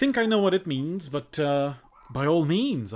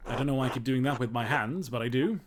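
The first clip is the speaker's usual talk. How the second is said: normal talking voice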